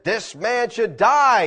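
slow, deliberate sentence; stern verdict. Retold or imitated. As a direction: pronounce it like a king's judgment. David passing judgment.

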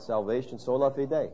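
normal talking voice

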